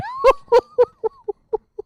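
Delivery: very high, loud shriek of laughter. Real, not acted. Streamer cracking up at a clip, shrieking laugh.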